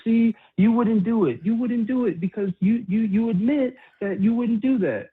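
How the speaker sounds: high, slow gloating mock voice; mocking imitation. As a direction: parody the opponent, smug and high-pitched. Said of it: "you're gonna be like".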